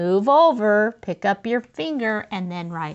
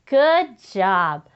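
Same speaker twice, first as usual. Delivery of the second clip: ordinary delivery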